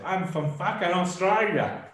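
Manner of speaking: imitating an indignant Aussie rugby mate in an Australian accent. Winding up the Aussie lads, in an Australian accent. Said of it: "That was a great accent".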